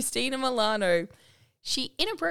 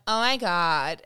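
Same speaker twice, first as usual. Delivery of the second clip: lower, drawled mock LA; Kardashian accent; 4 semitones lower, 4 dB louder, slower than usual. Teacher mimicking for her students, Kardashian accent. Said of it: "they call it my Kardashian accent".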